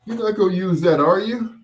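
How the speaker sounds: high, loud eager ask; eager kid. Retold or imitated. Kid asking a neighbour for his gear, high and eager. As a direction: push hopefully for it. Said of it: "I'm like".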